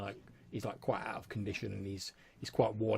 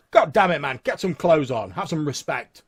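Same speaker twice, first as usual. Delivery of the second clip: loud, raised-pitch scolding; indignant sheriff; 5 semitones higher, 13 dB louder than usual. Acted. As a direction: tell off a naked man indignantly. Sheriff scolds a naked man.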